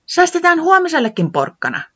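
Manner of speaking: high little voice; Tiputi answering. Acted. Tiputi declining a carrot, high. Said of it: "Tiputi vastasi".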